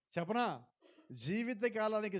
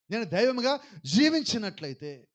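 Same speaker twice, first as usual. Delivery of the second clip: loud preaching climax; 7 dB louder than usual. Real, not acted.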